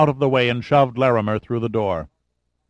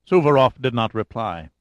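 usual speaking voice

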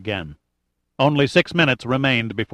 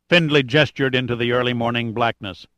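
usual speaking voice

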